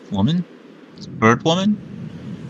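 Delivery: lightly voiced bird girl. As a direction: read the bird girl's lines with light admiration. Reads Medli's lines in voice.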